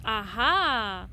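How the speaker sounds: ordinary delivery, nothing marked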